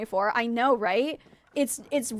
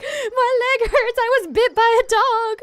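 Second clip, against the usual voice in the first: high, loud tearful wail of a retold sob; mock sobbing as she tells it, pitched way up; crying whine; 11 semitones higher, 6 dB louder, slower than usual. Crying retelling to husband.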